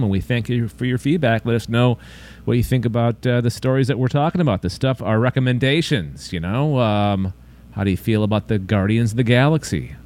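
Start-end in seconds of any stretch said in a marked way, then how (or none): none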